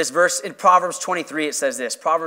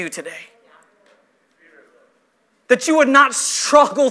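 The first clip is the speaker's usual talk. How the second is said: raised, emphatic sermon voice; projected pulpit fervor; impassioned preaching; 7 semitones higher, 6 dB louder than usual. Real, not acted. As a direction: drive each point home to the congregation. Preaches to the congregation.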